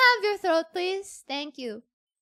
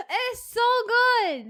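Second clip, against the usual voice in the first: gushing; 7 semitones higher, 5 dB louder, slower than usual. Real, not acted. Overwhelmed gushing over singing.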